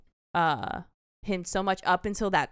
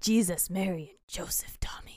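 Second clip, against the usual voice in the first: imitating a hushed line; 3 dB quieter than usual. Fan imitating Dafoe's line, softly whispered. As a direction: breathe the last words out. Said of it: "He goes".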